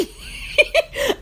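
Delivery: wheezing breathless laugh; a soundless high wheeze of laughter; high silent laugh. Real, not acted. Cracking up at the girl's comeback, a silent wheeze.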